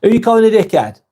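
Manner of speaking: mock outrage in a broad Lancashire accent. Streamer jokingly challenging a viewer in broad Lancashire accent. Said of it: "I'm using my, uh, Lancashire accent there".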